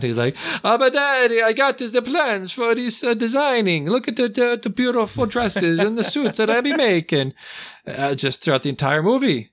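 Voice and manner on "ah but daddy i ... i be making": much higher, hammed-up Italian accent; mocking the actor's wild Italian accent; over-the-top Italian accent. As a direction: squeal it like a spoiled son. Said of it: "bonkers in this movie with this accent".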